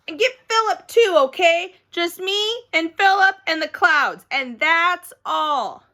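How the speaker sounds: high, loud insistent orders; bossy excited kid. Acted. Girl ordering mother how to take picture, bossy. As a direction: direct the shot eagerly.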